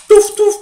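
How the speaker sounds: touf touf lancé fort et aigu; bruitage rapide. Acted. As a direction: imite le claquement d'une carte posée vite. Bruitage de carte jouée vite. (bruitage sonore et aigu)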